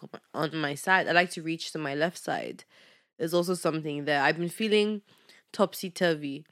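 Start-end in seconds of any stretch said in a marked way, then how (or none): none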